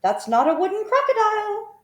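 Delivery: a little bird calling out in warning, high and loud; singsong bird voice. A narrator's reading. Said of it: "sang the roly-poly bird".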